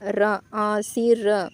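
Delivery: रा, आशीर drawn out syllable by syllable, very slow; slow spelling out. Shown as a demonstration. Teacher breaking the word into syllables for students, very slow. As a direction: spell out रा then आशीर slowly for the class. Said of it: "आशीर्वाद को कैसे लिखा जाएगा".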